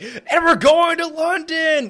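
high, slow, stretched-out hype announcement; excited announcer. Retold or imitated. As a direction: belt it out like an MC revealing big news. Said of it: "he's really excited".

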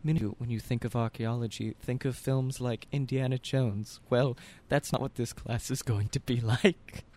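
low put-on teacher voice; mock professor, pitched well down; teacher's lecture voice. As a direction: drop the pitch and lecture the class. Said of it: "the teacher just goes".

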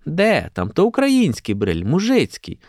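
high, emphatic motherly put-down; dismissive correction. A narrator's reading. Mother correcting her daughter about the hat, dismissive.

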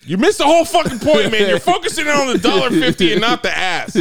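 loud, high exasperated rant; exasperated shouting. Real, not acted. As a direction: yell it out in disbelief.

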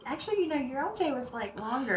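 soft, tentative explaining; retold careful, quiet reasoning; gentle correcting parent. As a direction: break it to a child very softly. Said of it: "trying to be like".

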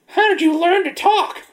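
high, slow incredulous voice; squeaky disbelief; mock high voice. Player line voiced high.

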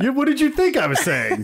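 high, laughing protest. Real, not acted. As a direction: say it through real laughter. Joking, cracking up together.

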